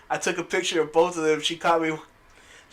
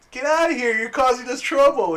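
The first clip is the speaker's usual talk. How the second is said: mock yell; 8 semitones higher, 3 dB louder than usual. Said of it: "He's like".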